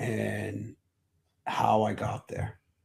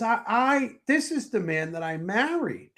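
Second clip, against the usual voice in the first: amazed wife; 10 semitones higher than usual. Retold or imitated. Wife realising husband has changed.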